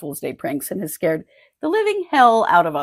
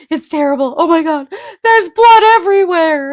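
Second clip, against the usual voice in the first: high, distraught wail, rising louder; mock panic; 9 semitones higher, 6 dB louder than usual. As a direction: imitate a horrified caller losing it. Acting out dad's fake panicked call.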